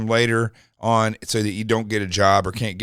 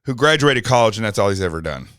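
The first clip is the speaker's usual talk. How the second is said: normal talking voice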